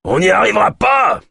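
high, strained-up cry of despair; loud exclamation, pitch soaring; desperate growling shout. A narrator's reading. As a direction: shout it as if all is lost. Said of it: "grogna Kolb".

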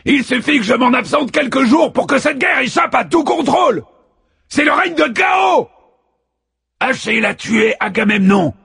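furious war god shouting high; battle roar. A narrator's reading. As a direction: bellow it across the hall. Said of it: "rugit le dieu de la guerre". (Arès rugit contre les dieux, furieux)

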